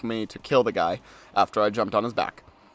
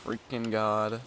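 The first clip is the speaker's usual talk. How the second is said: hushed exasperation; 3 semitones lower, 10 dB quieter, slower than usual. Real, not acted.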